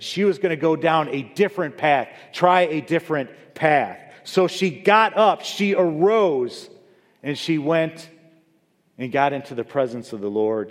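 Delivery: loud, high, rising sermon climax; preaching at full force. Acted. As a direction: build it up like a revival preacher. Preacher builds to a peak.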